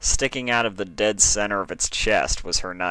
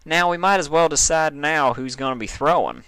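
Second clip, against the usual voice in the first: Applejack lightly voiced, higher, matter-of-fact; a practical friend brushing off worry, a touch higher; 8 semitones higher, 3 dB louder than usual. A narrator's reading. Applejack reassuring Dash about the knife practice, lightly voiced.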